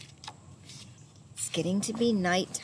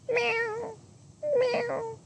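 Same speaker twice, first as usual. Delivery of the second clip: much higher, thin cat meow. A narrator's reading. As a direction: mew like a little cat.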